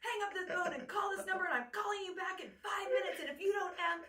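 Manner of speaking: high, loud frantic yell; frantic sister screaming down the line. Retold or imitated. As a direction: scream the ultimatum down the phone. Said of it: "I was screaming at you".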